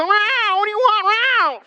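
high sing-song call to the cat; high voice answering a screaming cat. Retold or imitated. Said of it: "we go".

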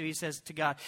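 ordinary delivery

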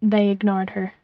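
plain reading voice

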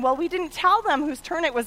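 ordinary talk, nothing marked

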